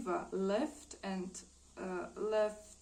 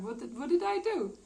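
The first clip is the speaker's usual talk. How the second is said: protesting innocence; 7 semitones higher, 4 dB louder than usual. Retold or imitated. Man protests his innocence.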